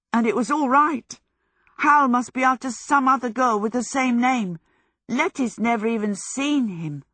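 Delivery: high, loud, happy rush of news; elated relief. A narrator's reading. Michael tells Sophie his Letty is safe, elated voice. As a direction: share the good news triumphantly.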